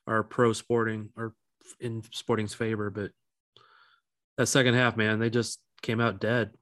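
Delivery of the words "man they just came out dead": ordinary talk, nothing marked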